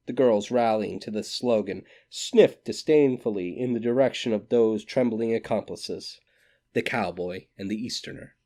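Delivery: plain reading voice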